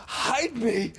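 high, hoarse cry of panic. Acted. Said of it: "he cried hoarsely".

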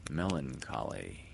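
usual speaking voice

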